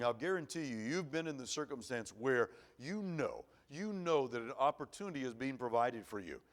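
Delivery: usual speaking voice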